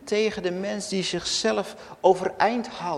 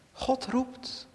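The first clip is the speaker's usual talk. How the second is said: ordinary talk, nothing marked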